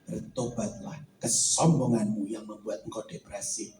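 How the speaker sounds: the Lord speaking low and soft to Vincent; voice of God. Acted. God calls him to repent.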